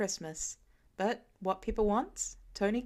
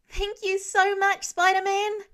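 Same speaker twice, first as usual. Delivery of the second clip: put-on much higher, louder, excited child's voice; little girl; 11 semitones higher, 9 dB louder than usual. A young girl thanking Spider-Man for saving her puppy, high excited child voice. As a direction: squeal it up high like a gap-toothed kid.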